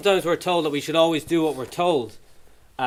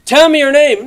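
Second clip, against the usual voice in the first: exasperated demand; 8 semitones higher, 12 dB louder than usual. Retold or imitated. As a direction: lose patience and demand it. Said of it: "policeman insisting".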